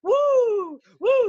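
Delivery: high, loud, drawn-out "woo" whoop; mock wrestler's woo. Commentator giving his partner a woo, loud high whoop. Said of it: "in the Ric Flair match".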